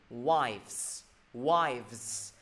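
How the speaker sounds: WIVES with a clearly voiced V and Z ending; model pronunciation. Shown as a demonstration. Teacher modelling the plural for students, WIVES with audible v-z ending. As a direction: draw out WIVES, buzzing the final -ves. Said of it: "Đọc gì?".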